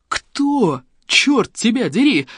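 high, drawn-out exclamation; outraged cry. A narrator's reading. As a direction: cry out in exasperation, stretching the words. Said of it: "вскричал друг Жанны".

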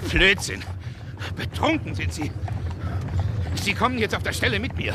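put-on furious friend scolding a drunk; angry rebuke. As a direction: snap at the drunk.

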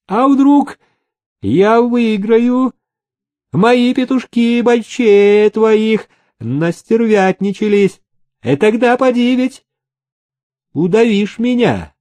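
put-on high, slow, eerie old cripple voice; unnervingly calm, pitched high; unhinged villain. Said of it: "невозмутимо сказал паралитик". (зловещий голос старого паралитика)

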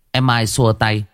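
plain reading voice

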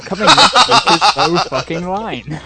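mock announcer.

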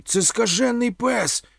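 loud, high, drawn-out yell; shouted warning. A narrator's reading. Vasyl warning about the dog, shouting. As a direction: call out the danger urgently. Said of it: "крикнув Василь".

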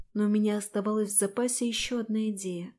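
plain reading voice

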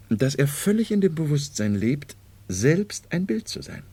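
ordinary talk

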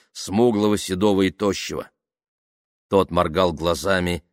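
ordinary delivery, nothing marked

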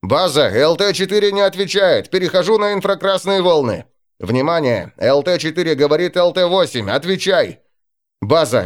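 pilot over the radio. Acted. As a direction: report tensely over the radio.

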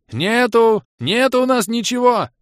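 high, loud panicked wail; wailing shout. A narrator's reading. Bom guards the wine from newcomers, wailing. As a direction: cry out in desperate denial. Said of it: "завопил Бом".

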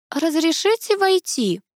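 high, slow, tentative request; timid-sounding high young voice; quiet-voiced visitor. A narrator's reading. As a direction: ask politely to come in, drawing it out. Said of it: "раздался тихий голос".

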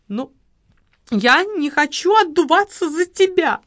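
high, loud shrill protest; cry out. A narrator's reading. Widow cries out at her lover, high and loud. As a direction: refuse the blame out loud. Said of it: "вскрикнула женщина".

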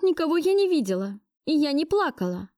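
high, insistent denial; refusing to give in. A narrator's reading. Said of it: "Не сдавалась я". (упрямо, высоким голосом)

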